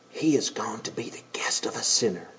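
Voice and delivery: whispered grumble; muttered gossip; muttering crowd. Acted. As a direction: breathe it out like gossip behind hands.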